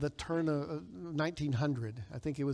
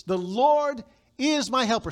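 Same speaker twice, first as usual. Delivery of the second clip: confident declaration; 6 semitones higher, 6 dB louder than usual. Shown as a demonstration. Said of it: "So we say with confidence".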